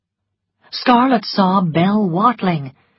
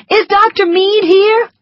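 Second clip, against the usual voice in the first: cried out; 7 dB louder than usual. A narrator's reading. Scarlett calling for the doctor among the wounded, loud cry. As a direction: call his name across the field. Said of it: "cried Scarlett".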